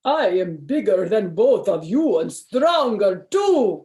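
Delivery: hammed-up vampire boast. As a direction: brag like a vampire. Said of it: "boasted the vampire".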